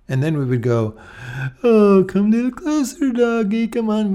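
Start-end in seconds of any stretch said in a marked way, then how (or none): 1.6-4.1 high, cooing doggy voice; sugary high-pitched pet talk; sweet coaxing tone. Acted. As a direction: coax the dog closer sweetly. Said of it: "it's responding to the tone".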